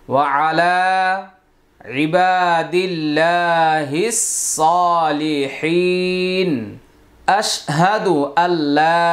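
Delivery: slow, drawn-out Quranic recitation; measured tashahhud chant. Shown as a demonstration. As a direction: recite each phrase slowly with long pauses.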